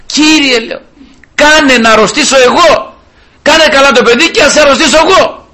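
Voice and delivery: high, loud imploring cry to the Lord; urgent plea. Retold or imitated. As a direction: beg to take the illness instead. Retold urgent plea to God. (ψηλή, δυνατή ικεσία στον Κύριο)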